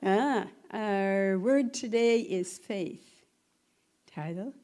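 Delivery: ordinary delivery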